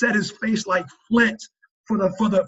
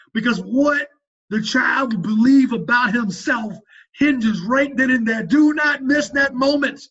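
higher, emphatic preacher's exhortation; impassioned preaching; 5 semitones higher, 3 dB louder than usual. Real, not acted. Urging dads not to miss the moment, fervent preaching. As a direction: drive it home, leaning on key words.

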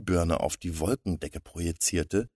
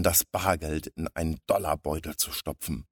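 ordinary delivery

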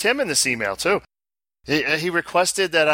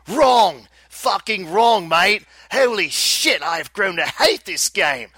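Aussie rant; 2 semitones higher, slower than usual. Acted. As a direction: blow up like a fed-up Aussie mate, Australian accent. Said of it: "requested I do the Australian accent".

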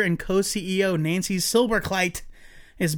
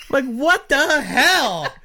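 slow, laughing disbelief; incredulous laugh; 6 semitones higher, 3 dB louder, much slower than usual. Real, not acted. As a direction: stretch it out in amused shock. Disbelief at boss story.

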